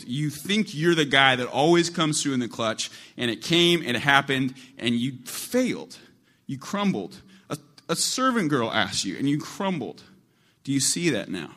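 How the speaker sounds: loud, high, pressing rebuke; preacher confronting Peter with his failure, loud; preaching at full force. Acted. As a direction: hammer home Peter's failure.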